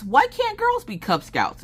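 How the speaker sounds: put-on high, slow, indignant little-girl voice; little girl's voice. Mother mimicking her daughter asking the scout leader, high and slow. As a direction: pipe up like a hurt little kid. Imitating daughter Maggie at the table.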